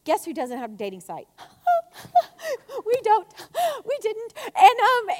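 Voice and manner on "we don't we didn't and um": high, breaking into laughter; laughing. Real, not acted. Preacher admits the dating site never happened, laughing. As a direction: talk through your own laugh, voice lifted.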